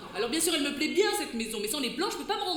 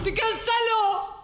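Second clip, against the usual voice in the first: angry; 8 semitones higher, 5 dB louder, much slower than usual. Acted. Couple quarrel, she insults him.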